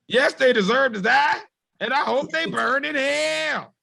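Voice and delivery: high, theatrical declaration; hammed-up outburst. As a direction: cry it out in a high voice, drawing out the end. Quotes a line, hammed-up outburst.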